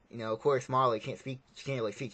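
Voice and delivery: usual speaking voice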